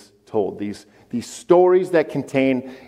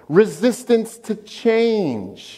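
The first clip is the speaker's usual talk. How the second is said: drawn-out mock reluctance; 6 semitones higher, much slower than usual. Mock dragging resistance to change.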